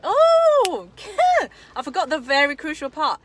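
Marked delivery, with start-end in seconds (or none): 0.0-1.7 burst of laughter. Real, not acted. Remembers forgotten crucial part.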